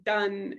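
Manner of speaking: done, slow, with a d for the s; stopped s. Shown as a demonstration. Therapist modelling stopping for parents. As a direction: put a quick d in place of s. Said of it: "Stopping is really common".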